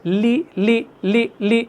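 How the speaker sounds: لی said thin and light, repeated; لی drilled with a fine, thin ل; thin tarqeeq. Shown as a demonstration. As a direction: lighten the ل in لی each time. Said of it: "ترقیق ہے".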